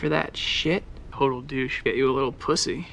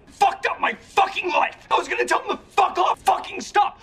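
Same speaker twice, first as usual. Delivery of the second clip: screaming curses. Acted. Peak of the tirade, shrill.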